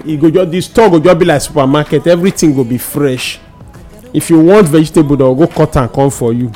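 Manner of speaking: ordinary delivery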